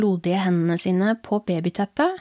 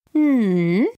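a louder, raised eerie hum; strange father voice; 3 semitones higher, 4 dB louder than usual. Acted. Possessed father answers the boy with an odd hum. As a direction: hum back like something wearing dad's face. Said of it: "svarte faren med den rare stemmen".

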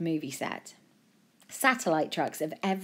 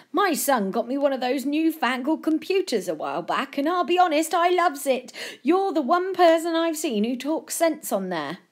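put-on high, louder, gushing old fan; admiring old gent; 9 semitones higher, 6 dB louder than usual. Old man praising Joe from the internet, gushing and high.

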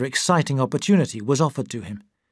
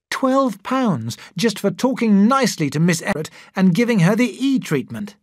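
raised, eager and proud; excited; 6 semitones higher, 4 dB louder than usual. Acted. Andrew shows his wife the cheque, excited and proud. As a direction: show off the cheque, thrilled. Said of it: "he excitedly showed Christine".

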